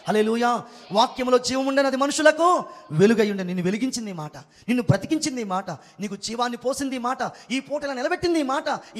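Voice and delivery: loud, rising proclamation; forceful preaching. Acted. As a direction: drive each phrase home to the congregation. (గట్టిగా, ఉద్వేగంతో వాక్య ప్రకటన)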